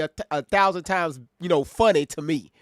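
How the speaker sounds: ordinary talk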